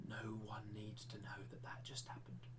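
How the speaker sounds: very soft, low whispered aside; confidential whisper. Pretend. Teacher covering up his accent slip, whispering.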